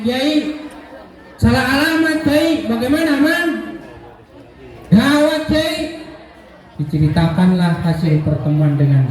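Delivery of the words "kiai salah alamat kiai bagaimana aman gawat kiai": high-pitched, mock-panicked report; acted alarm. Suitor tells his kiai it all went wrong, mock alarm. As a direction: report a disaster in a hammed-up, high voice.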